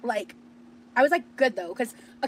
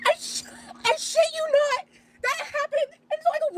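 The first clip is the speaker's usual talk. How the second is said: high squeal. Real, not acted. Overcome while retelling being recognized.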